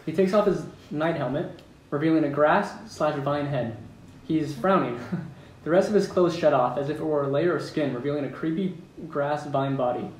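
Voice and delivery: ordinary talk, nothing marked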